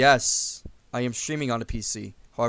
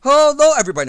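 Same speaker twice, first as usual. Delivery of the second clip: big booming youtuber intro greeting, much higher and louder; Markiplier; 13 semitones higher, 15 dB louder, much faster than usual. Retold or imitated. Friend imitating a famous youtuber's opening, hammed-up and loud. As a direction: belt out a hammed-up channel intro. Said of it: "My name is Markiplier".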